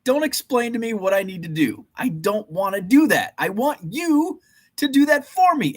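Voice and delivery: high, whining, pleading voice; high mock-desperate whine; whiny rant. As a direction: beg like a fed-up child. Ranting plea about internet.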